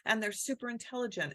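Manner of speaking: ordinary talk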